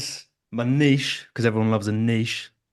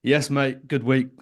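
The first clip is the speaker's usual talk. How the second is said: ordinary delivery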